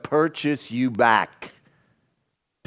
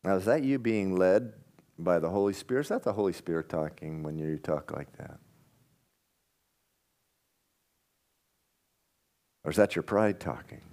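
soft probing question; 5 semitones lower, 9 dB quieter than usual. Real, not acted. Pastor probing after mock vows.

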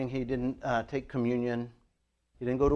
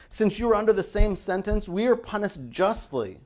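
mild reproof, pitched up; rebuking; 7 semitones higher than usual. A narrator's reading. Preacher voicing one criminal rebuking the other, rebuking. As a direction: press the rebuke in a higher voice. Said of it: "he rebukes the other".

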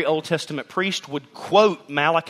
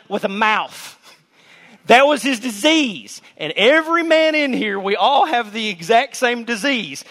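much higher, louder preaching; preaching at full force; 10 semitones higher, 6 dB louder than usual. Acted. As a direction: preach it with fire and disbelief.

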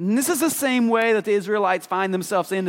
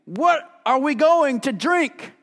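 much higher, slow whiny complaint; mock-whiny, drawn-out grumble of the people; grumbling complaint; 9 semitones higher, 3 dB louder than usual. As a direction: whine it like a thirsty, complaining crowd. Reading grumbling Israelites in scripture.

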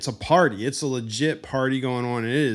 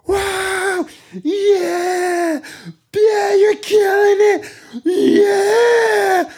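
put-on high, loud, strained cheering for his drunk buddy; hyped groupie; 7 dB louder, much slower than usual. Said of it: "And he's just like".

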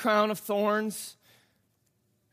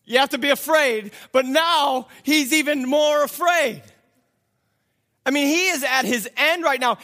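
louder, higher, driving sermon build; fiery preaching; 8 semitones higher, 6 dB louder than usual. Acted. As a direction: rouse the congregation to feel his panic. Preaching at full force.

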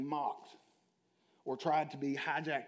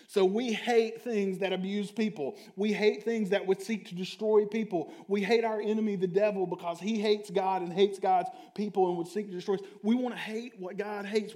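louder, rising preacher's build; preaching at full force; 4 semitones higher, 6 dB louder than usual. Acted. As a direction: drive it home to the congregation.

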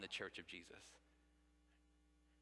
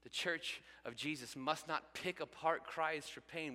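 slow emphatic preaching; 5 semitones higher, slower than usual. Acted. Slowed emphatic sermon line.

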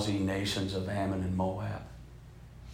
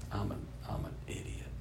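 very soft, near-whispered; hushed retold regret; remorseful self-reproach; 15 dB quieter than usual. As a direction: mutter it to yourself, ashamed. Retelling his remorse after shoving son.

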